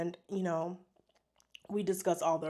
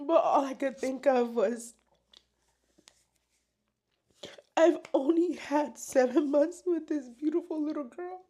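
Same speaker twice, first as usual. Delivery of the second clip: choked up, higher tearful voice; weepy, pitch raised, halting between sobs; real crying; 5 semitones higher than usual. Real, not acted. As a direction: push the words out through tears, with long breaks to recover. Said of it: "I don't wanna cry".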